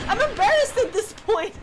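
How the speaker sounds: high, loud words through laughter; laughing. Real, not acted. Streamer laughing at her own scare, words through laughter. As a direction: laugh it out, flustered.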